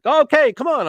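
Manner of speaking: retold as an upbeat call to come along; breezy agreement. Pilot agreeing to take a friend's kid along, breezy agreement.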